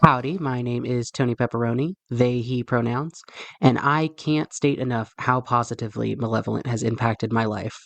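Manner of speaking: ordinary delivery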